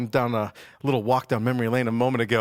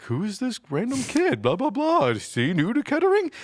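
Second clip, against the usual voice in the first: high, puzzled internet viewer; curious viewer voice; 8 semitones higher than usual. Retold or imitated. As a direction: voice a confused stranger. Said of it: "people out there ... who are like".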